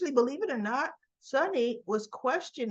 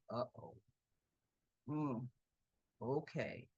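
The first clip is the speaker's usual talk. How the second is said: very low, soft murmur; imitating a quiet realisation of trouble; uneasy aside; 9 semitones lower, 12 dB quieter, slower than usual. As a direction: say it under your breath, low and wary. Onlooker's uneasy aside, voiced.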